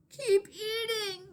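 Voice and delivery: high, slow scolding cry. A narrator's reading. Maria scolding her cousins, high and slow. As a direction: scold the cousins to keep eating. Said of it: "Maria scalded".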